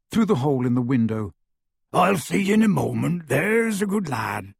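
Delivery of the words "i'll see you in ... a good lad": lightly voiced big man, fond; a gentle promise to his brother; Hagrid's voice. A narrator's reading. Hagrid reassures Grawpy through the window, lightly voiced.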